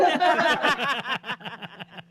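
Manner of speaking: a long real laugh at her own story; hearty laugh. Real, not acted. Laughs after her own joke.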